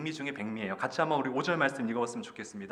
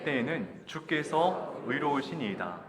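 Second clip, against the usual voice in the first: loud, slow, measured verse reading; scripture reading aloud; 3 semitones higher, 4 dB louder, slower than usual. Acted. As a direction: lead the congregation through the verse, drawing out each phrase. Leads congregational scripture reading. (천천히 힘주어 읽는 성경 봉독)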